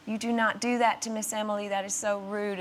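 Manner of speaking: Southern mum. Retold or imitated. Mum scolding her son about manners, Southern US accent. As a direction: imitate a mannerly Southern mother telling off her child. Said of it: "his mum is from the South".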